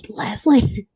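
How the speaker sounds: high, loud hissed holler; mock yell across plane. Brother calling his sleeping wife across the plane, mock scream. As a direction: imitate someone screaming a name down the cabin. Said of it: "yelling across the plane".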